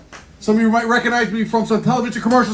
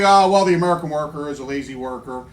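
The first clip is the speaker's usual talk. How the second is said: corporate boss; 9 semitones lower, 4 dB quieter than usual. Retold or imitated. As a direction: imitate a ponderous company man. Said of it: "They say".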